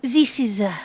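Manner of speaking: halting, hammed-up French accent. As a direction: fumble through a fake French accent. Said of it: "le French accent".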